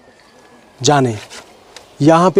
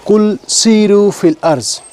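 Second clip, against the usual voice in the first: slow, recited Quran verse; a slow Quranic recitation; quoting the Quran; 3 semitones higher, slower than usual. Shown as a demonstration. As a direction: recite the verse slowly, with reverence. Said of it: "اللہ تعالیٰ سے قرآن پاک میں فرماوا".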